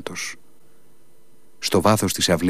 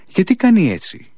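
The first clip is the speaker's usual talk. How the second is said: puzzled; 10 semitones higher, 4 dB louder than usual. A narrator's reading. Colonel puzzled by the monk.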